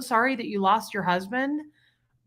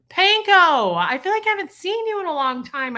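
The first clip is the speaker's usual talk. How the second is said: excited greeting; 9 semitones higher, 6 dB louder than usual. Real, not acted. Greeting long-absent viewer.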